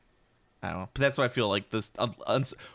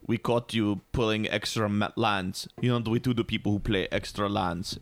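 Russian; 3 semitones lower than usual. Acted. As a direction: threaten in a mock Russian accent. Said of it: "the Russians are gonna be like".

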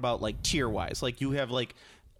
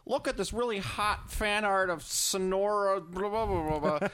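mock Reddit poster; 7 semitones higher, slower than usual. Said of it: "they'll just be like".

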